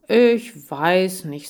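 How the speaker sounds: put-on a touch of a shrugging boy; hesitant boy. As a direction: drag it out doubtfully. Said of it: "sagte er".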